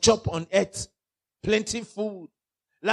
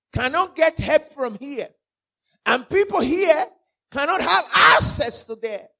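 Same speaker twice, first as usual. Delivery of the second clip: Lazarus answering; 5 semitones higher, 6 dB louder than usual. Acted. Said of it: "Then Lazarus answered".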